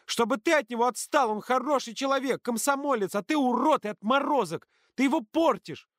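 high-pitched furious tirade; shrill-pitched, heated scolding; angry accusation. A narrator's reading. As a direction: hurl the accusations in a heated quarrel. Shouted quarrel, girl's accusation.

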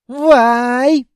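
put-on high, loud Caucasian accent taunt. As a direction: yell across in a Caucasian accent. Jinn exclaims in accent.

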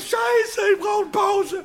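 very high desperate yell; shout. Retold or imitated. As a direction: scream for a break. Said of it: "Und ich gesagt". (verzweifelter Schrei)

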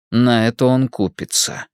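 plain reading voice

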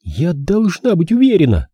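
high-pitched stubborn insistence. A narrator's reading. Girl refuses to back down, stubborn high voice. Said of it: "упрямо повторила она".

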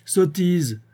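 father brushing off his daughter, scornfully. A narrator's reading. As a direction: dismiss it with scorn. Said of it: "répondit le père".

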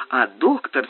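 plain reading voice

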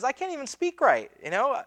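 high-pitched mock self-doubt; imitated protesting Moses. Moses making excuses to God, high mock protest. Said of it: "he goes on and says".

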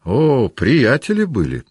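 high, drawn-out happy exclamation; cheerful. A narrator's reading. Old commander recalls a friendship, cheerful and drawn-out. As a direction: swoop up merrily and stretch the words. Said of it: "весело сказал Кутузов".

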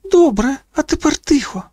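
put-on very high girl's voice; hushing girl. A narrator's reading. Kalisha hushes Iris.

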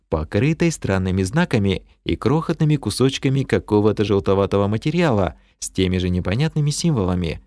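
ordinary delivery, nothing marked

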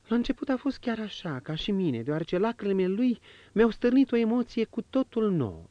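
a noblewoman pouring out her heart, at times quick; marchioness's voice. Acted.

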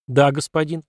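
plain reading voice

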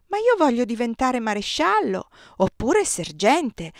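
put-on high, loud, slow child voice, all fired up; a little boy insisting on his dream; child excited; eager child. A narrator's reading. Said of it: "si infervorava".

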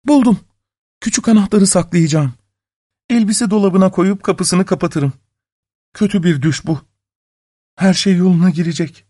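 high-pitched woman's character voice; young wife talking to herself. A narrator's reading. Wife plotting to hide the key, high anxious voice.

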